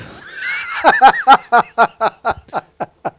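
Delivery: a big shouted laugh; loud laugh. Real, not acted. Commentator laughing loudly at the cobbles. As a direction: burst of high loud laughter.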